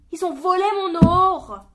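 loud high broken cry; voice cracking with spite. A narrator's reading. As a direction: let the voice crack with rage. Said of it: "sa voix déraillée de dépit".

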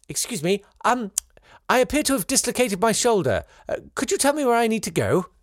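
polite RP English, as if asking for the time; RP accent. Retold or imitated. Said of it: "uttered in my finest R P".

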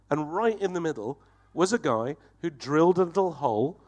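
usual speaking voice

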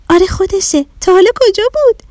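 high, quick excited question; excited girl. Acted. Shadi spotting the saucer, high and quick. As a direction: point at the sky and blurt it out.

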